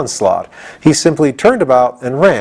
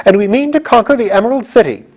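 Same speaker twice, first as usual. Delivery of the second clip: impatient; 11 semitones higher than usual. Acted. General Ginger stamps at the gatekeeper, high and impatient. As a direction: insist impatiently, hitting are. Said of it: "cried Ginger".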